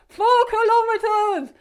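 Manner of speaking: high, loud, very slow shouted reply; shouting over wind. Retold or imitated. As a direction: yell it back over the wind. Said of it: "she's like".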